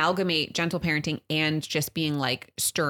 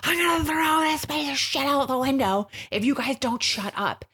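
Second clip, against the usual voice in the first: snapping yell; 9 semitones higher than usual. Retold or imitated. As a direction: threaten the kids, fed up and shouting. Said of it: "I snapped".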